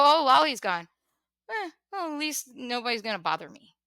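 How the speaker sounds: mock a careless friend relieved she's gone; imagined indifferent reaction. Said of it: "that's how I felt the reaction would be".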